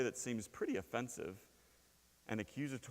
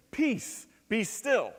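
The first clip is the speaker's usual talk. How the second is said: high, loud command to the sea; commanding call; 7 semitones higher, 6 dB louder, much slower than usual. Acted. Jesus rebuking the wind, loud high command. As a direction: order the storm to stop.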